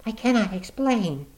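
high woman's voice, low and eager. Acted. Woman pleading with Watson, low and eager. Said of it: "She spoke in a low, eager voice".

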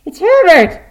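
screamed very high and loud; wild, high old woman's scream. A narrator's reading. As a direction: shriek it out at full force. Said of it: "she screamed".

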